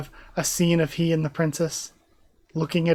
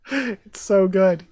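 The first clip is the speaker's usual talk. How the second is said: normal talking voice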